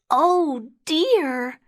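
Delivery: put-on high-pitched child's voice; high, worried storybook girl; dismayed little girl. A narrator's reading. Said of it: "she said".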